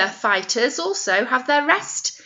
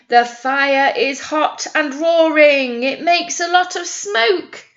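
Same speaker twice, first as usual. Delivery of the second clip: slow, sing-song picture book reading; reading to children; 4 semitones higher, 5 dB louder than usual. Acted. Nursery teacher reads the fire engine rhyme, sing-song. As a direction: read the rhyme aloud to little ones.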